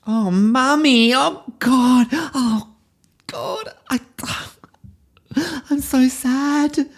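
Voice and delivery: very high and slow, hammed-up grief; milk the child's heartbreak, over the top; theatrical little-girl sobbing lament; wailing little girl. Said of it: "we go into the emotion".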